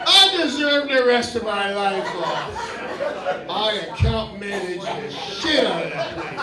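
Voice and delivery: lazy retiree. Acted. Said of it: "laying in bed going".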